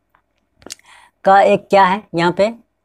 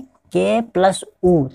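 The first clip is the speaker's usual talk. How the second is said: slow spelled-out sounds; much slower than usual. Shown as a demonstration. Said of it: "समझ में आया है".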